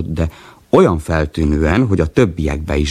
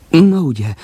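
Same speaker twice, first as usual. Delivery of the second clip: high, bright-eyed triumphant burst, much higher; happy; 12 semitones higher than usual. Acted. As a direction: crow it happily. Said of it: "mondta boldogan".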